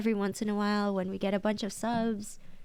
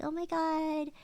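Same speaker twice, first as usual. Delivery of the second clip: put-on high, quick cutesy anime girl; 6 semitones higher than usual. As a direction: squeal it out like a cutesy idol. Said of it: "She's probably gonna be like".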